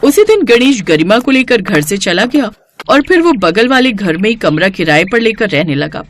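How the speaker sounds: plain reading voice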